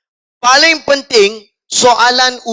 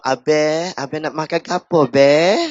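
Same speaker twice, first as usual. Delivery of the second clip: softer, lower sweet voice of a Kelantan waitress; lightly voiced Kelantan girl cooing; cute Kelantan girl; 5 semitones lower, 4 dB quieter than usual. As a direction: coo it like a shy girl asking abang. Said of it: "tanya awak".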